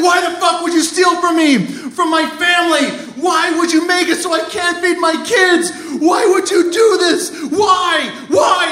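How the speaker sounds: anguished high-pitched yelling; acted hysterical outrage; screaming at him. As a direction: wail each question like a wounded boss. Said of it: "and starts screaming at him".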